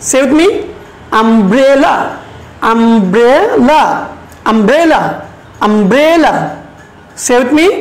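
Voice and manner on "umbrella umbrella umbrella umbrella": "umbrella" with a long, stressed middle syllable; stress on BRE. Shown as a demonstration. Teacher leads students in saying "umbrella", middle syllable stressed. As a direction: swell on the middle of "umbrella", then fall away. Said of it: "সেই অংশটুকুতে আমরা জোর দিব".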